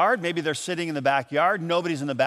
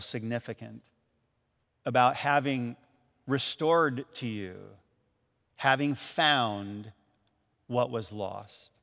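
reflective sermon; 5 semitones lower, 6 dB quieter than usual. Acted. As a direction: let each word land quietly. Reflective preaching moment.

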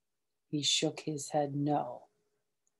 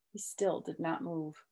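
plain reading voice

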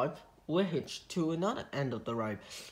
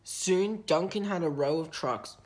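ordinary delivery, nothing marked

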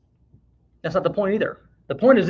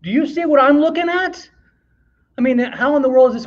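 incredulous; 7 semitones higher than usual. Real, not acted. Incredulous at the odds.

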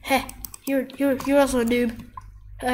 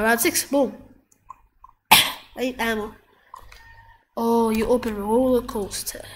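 normal talking voice

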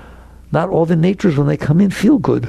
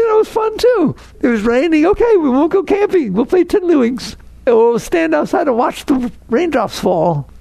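cheerful acceptance; 10 semitones higher than usual. Retold or imitated. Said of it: "you'd say".

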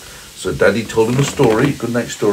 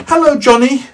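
snowman voice; 9 dB louder than usual. Acted. Parent voicing the snowman.

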